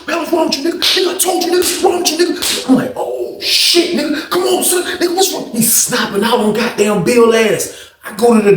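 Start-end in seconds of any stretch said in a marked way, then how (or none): 0.1-2.7 high-pitched mock outburst from a fight; heated outburst. Retold outburst mid-fight. 3.0-5.5 retold alarm.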